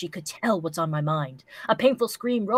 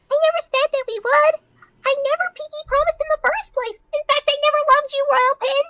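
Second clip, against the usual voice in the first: much higher, louder pony sneer; mean pony voice; 6 dB louder than usual. Acted. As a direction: spit it out as a cruel cartoon pony. Character rejects lover in reading.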